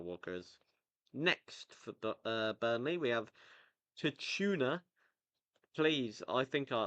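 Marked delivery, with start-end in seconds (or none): 4.0-4.8 Tachuna said as an unsure, higher-pitched attempt; butchered name. Real, not acted. Podcaster attempting a new Burnley signing's name, butchered pronunciation. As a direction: have a clumsy go at the name Tachuna. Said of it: "I definitely butchered that one".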